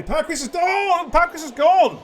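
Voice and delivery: high, loud excited shout. Real, not acted. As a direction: yell it as the car crashes. Live commentary, car crashes out.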